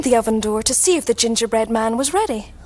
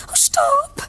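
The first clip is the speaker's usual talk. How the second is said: high, airy mock shout; little girl's shout. A narrator's reading. Little girl seeing the gingerbread man, high mock shout. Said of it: "shouted the little girl".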